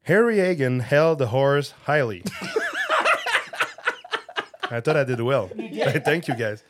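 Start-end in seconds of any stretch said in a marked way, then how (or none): 0.0-2.2 Harry Hagen held the horse highly, fuerte y serio; serious loud reading. Shown as a demonstration. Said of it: "how serious and well you did it". 2.3-4.6 long high-pitched laugh; high burst of laughter. Real, not acted. Laughs at own attempt.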